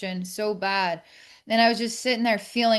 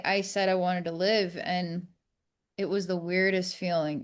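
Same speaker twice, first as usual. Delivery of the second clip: normal talking voice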